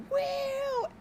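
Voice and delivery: very high, loud fake amazement; mock crowd wow. Mocking the rally crowd, high loud fake wow. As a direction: squeal a dumb amazed "wow". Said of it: "everyone was just like".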